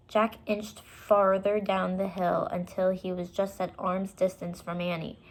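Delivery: ordinary delivery, nothing marked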